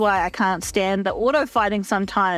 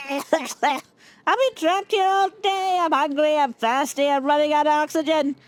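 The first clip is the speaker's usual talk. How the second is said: put-on high, whiny trapped old man; old guy voice; 5 semitones higher than usual. Voicing the trapped old guy in the game, high and whiny. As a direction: whine like a weak old man begging. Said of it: "I'm giving him this voice".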